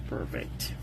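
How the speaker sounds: soft whispered approval. Real, not acted. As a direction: breathe it out, pleased. Muttered verdict on paper sample.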